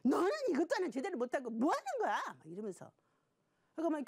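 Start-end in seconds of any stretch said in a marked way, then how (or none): 0.0-2.2 fast, high-pitched mock scolding; angry parent. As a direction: act out a parent snapping at a child. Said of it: "막 화를 낼 때가 있어요". (화내며 다그치는 부모 흉내)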